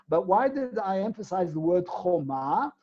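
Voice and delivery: ordinary delivery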